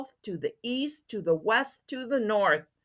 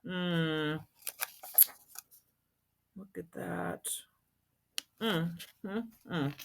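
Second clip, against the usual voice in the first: hushed aside; 4 semitones lower, 14 dB quieter than usual. Real, not acted.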